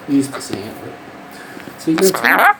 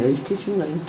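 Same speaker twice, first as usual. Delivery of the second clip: ordinary talk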